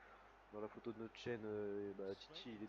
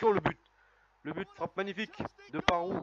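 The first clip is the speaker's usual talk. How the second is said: high, loud goal cry; goal shout; 8 semitones higher, 11 dB louder than usual. Real, not acted. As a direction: erupt as the ball hits the net. Goal scored in the final.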